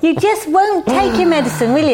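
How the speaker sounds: playful schoolmistress telling-off; co-host mock scolds Richard, hammed-up stern; mock scolding. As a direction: tut at him like a naughty pupil.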